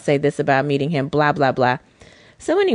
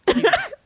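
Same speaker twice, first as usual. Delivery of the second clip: high giggle. Real, not acted.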